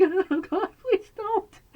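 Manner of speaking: high, tearful mock plea; replaying a tearful plea to the dentist; retold crying plea. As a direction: beg like you're about to cry. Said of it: "I cried every time".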